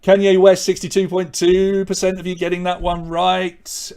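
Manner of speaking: hammed-up Austrian accent, bellowing the answer; Arnie Austrian accent. Host answers viewer's request with a loud mock Austrian accent. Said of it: "Sort of like Arnie, I suppose".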